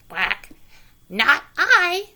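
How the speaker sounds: loud quacky refusal; a duck flatly refusing. A narrator's reading. Duck refusing the hen, loud and high. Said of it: "said the duck".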